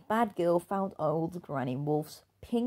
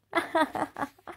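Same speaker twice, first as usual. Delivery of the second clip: high jeering laugh; mocking laugh; 11 semitones higher than usual. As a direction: laugh at the hat. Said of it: "people laughed at him".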